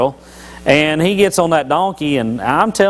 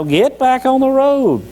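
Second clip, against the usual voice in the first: Balaam scolding; 9 semitones higher than usual. Retold or imitated. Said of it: "he's beating him with the stick again".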